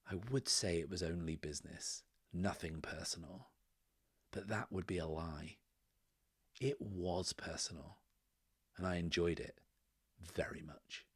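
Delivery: soft, measured near-whisper; whispering in his ear. Acted. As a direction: confide it softly and deliberately, close to the ear. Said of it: "and then whispers in the man's ear".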